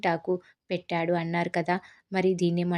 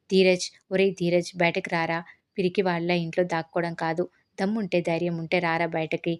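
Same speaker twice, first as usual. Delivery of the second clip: ordinary delivery, nothing marked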